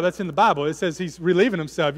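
ordinary talk

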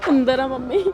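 crying. Acted. Wife tells her mother where she is, crying. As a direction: answer through tears.

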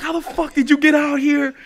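loud, high incredulous yell; re-enacted outraged holler; shouted challenge. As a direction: imitate soldiers yelling at a stray stranger. Re-enacts soldiers challenging him.

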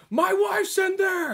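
high, slow yell of a betrayed husband; "My wife's in there!" cried out high and drawn; outraged husband. Retold or imitated. Biker bursting out of the trailer, high outraged yell. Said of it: "he's like".

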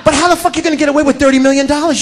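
ordinary talk

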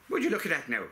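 a quick mock Irish accent on a cheeky question; lightly put-on Irish lilt, teasing the camera. Talking to the camera, in a light mock Irish accent. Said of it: "I've no idea what the Irish accent was".

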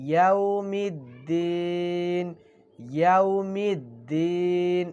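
ইয়াউমিদ্দিন stretched very slowly, the doubled দ held firm; clear recitation. Shown as a demonstration. Quran teacher demonstrating for students, slow clear recitation. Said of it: "স্পষ্ট?".

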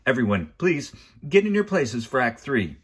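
loud, high call to places; shouted announcement. A narrator's reading. Man with clipboard sending actors to places, shouting. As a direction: holler it like a stage manager. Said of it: "a man with a clipboard shouted".

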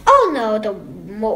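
ordinary delivery

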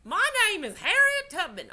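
put-on Southern accent, like a friendly storyteller; Harriet Tubman, Southern accent. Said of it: "say that she had a Southern accent".

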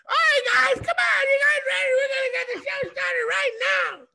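strained high shout into a mic over a noisy bar; yelling over crowd. Retold or imitated. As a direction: bellow it like a host nobody hears.

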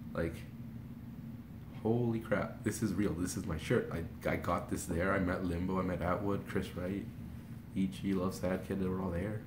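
soft, low, murmured to oneself; hushed disbelief. Retold or imitated. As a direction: take it in quietly, item by item. Retold private disbelief alone at home.

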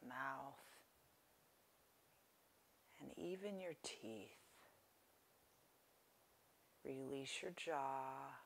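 softly paced phrases, leaving space to breathe; guided meditation. Real, not acted. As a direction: guide listeners gently into relaxation, unhurried.

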